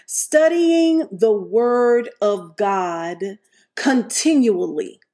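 drawn out, each word weighed; slow preaching emphasis; slow emphatic. Real, not acted. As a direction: spell out the principle slowly. Slow stated principle.

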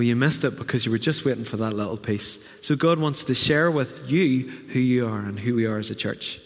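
normal talking voice

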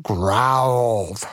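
storybook bear growl on the word; mock growl. A narrator's reading. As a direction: stretch the word into a bear's growl. Word acted as bear's growl.

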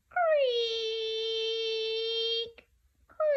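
long high-pitched creaks; very creaky door. Shown as a demonstration. Storyteller showing children a creaky door, long high creak. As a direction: draw out a squeaky creak. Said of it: "open a very creaky door".

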